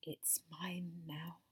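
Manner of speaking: slow possessive whisper in the ear. Retold or imitated. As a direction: breathe it slowly right into the ear. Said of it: "I whispered in your ear".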